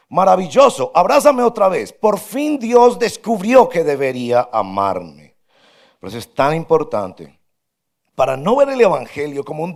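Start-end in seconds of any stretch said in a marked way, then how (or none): 0.1-5.4 higher, louder gleeful self-centred cry; delighted gushing. Retold or imitated. Self-centred believer gushing about God's love, louder, higher, delighted. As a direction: gush like someone flattered. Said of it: "quien piensa".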